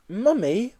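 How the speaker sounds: put-on high childlike call to mummy; little boy voice. Small boy calling to his mummy, high childlike voice.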